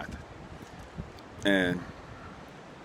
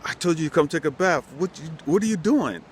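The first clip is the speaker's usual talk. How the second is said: mock scolding yell, pitched higher; retold yelling; 8 semitones higher than usual. Single mom yelling at her daughter over the overflowing bath, mildly mock yell. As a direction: snap at her like a fed-up parent. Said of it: "I'm yelling at her".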